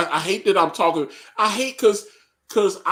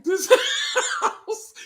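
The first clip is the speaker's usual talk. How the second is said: laughing. Retold or imitated. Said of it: "I was like".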